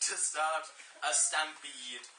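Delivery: ordinary delivery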